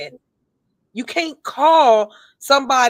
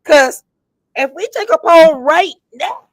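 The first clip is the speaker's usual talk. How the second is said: higher, louder eager push; excited; 8 semitones higher, 6 dB louder than usual. Real, not acted. Host asking her cohost for a poll, higher and louder. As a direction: pitch up with excitement over the poll.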